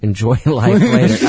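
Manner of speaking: laughing through the words. Real, not acted. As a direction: chuckle out the line. Repeats advice while laughing.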